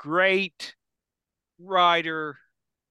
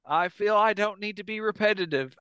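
ordinary delivery

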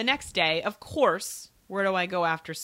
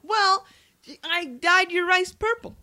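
cheeky; 9 semitones higher, 6 dB louder than usual. Retold or imitated. Teen's cheeky reply.

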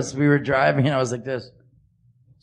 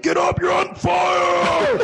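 mock shout; much slower than usual. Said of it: "He's like".

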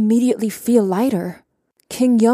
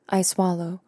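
plain reading voice

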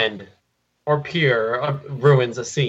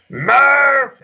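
"Murph!" shrieked far higher and louder, hammed-up; awful mock scream; 5 dB louder than usual. Friend mocking the Interstellar scene, shrill hammed-up scream. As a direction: butcher the movie scream on purpose. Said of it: "he just tries to imitate it, but he does it so awfully".

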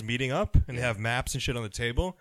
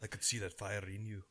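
put-on German accent; 5 semitones lower, 12 dB quieter than usual. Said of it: "putting on that James Bond with a German accent".

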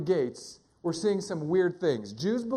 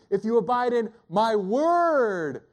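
long swooping emphasis on "word"; stress on word; 2 semitones higher, 4 dB louder, slower than usual. Shown as a demonstration. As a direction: build to a huge drawn-out "word". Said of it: "emphasize different words".

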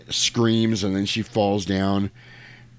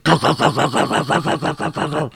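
mimicking a hospital scanner; machine noise imitation; 6 semitones higher than usual. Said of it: "the machine's, like, going".

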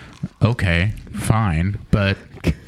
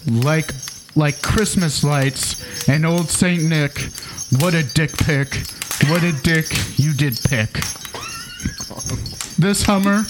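poem recital; 4 semitones higher than usual. Acted. Comic reading a Christmas poem to co-hosts, mock-solemn recital. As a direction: recite it like a sacred holiday verse.

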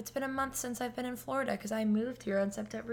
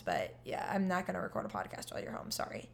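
ordinary delivery

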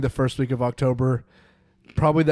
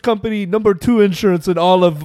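higher, louder Borat voice; Borat accent; 4 semitones higher, 6 dB louder than usual. Retold or imitated. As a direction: pitch it up like Borat. Borat impression.

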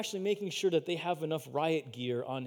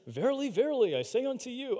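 high, lofty preacher-Jesus voice; Jesus imitation; 7 semitones higher than usual. Disciple mocking Jesus at table, high and lofty. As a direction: ham up the Jesus sayings, pitched high. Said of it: "he gives that Jesus imitation".

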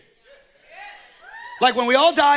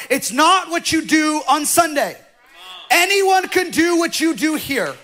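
full-force preaching; 3 semitones higher than usual. Acted. Pastor preaching to the church, full force. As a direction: preach it hard to the congregation.

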